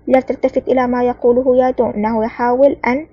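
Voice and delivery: ordinary delivery, nothing marked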